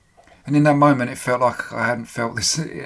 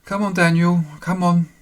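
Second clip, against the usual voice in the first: higher, lightly voiced woman urging him awake; female voice calling; 6 semitones higher, 6 dB louder than usual. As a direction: gently coax Daniel to wake up as a woman. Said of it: "A female voice".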